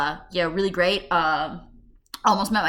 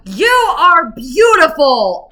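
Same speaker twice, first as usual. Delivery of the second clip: loud, high, drawn-out blurted shout; retold tic shout; 9 semitones higher, 12 dB louder, much slower than usual. Ticcing at the cashier, retold loud slow shout. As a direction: blurt it out slowly at full volume. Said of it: "I'm sorry I shouted".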